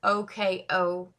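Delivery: slow letter-by-letter K U R O K O; each letter spoken apart, unhurried; spelling out letters. Shown as a demonstration. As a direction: spell the name out slowly. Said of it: "it is spelled".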